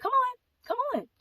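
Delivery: mock coaxing lady. Strange lady coaxing the daughter.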